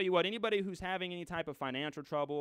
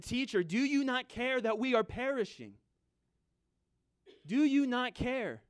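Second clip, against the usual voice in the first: frightened disciples; 9 semitones higher, 4 dB louder than usual. Acted. Frightened disciples waking Jesus in the storm, high anguished plea. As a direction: plead loudly, pitched well up. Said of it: "his disciples were so frightened".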